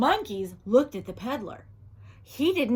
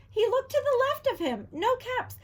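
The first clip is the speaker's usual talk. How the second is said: higher, quicker puzzled look around; searching higher; 11 semitones higher than usual. A narrator's reading. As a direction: raise it, growing puzzled.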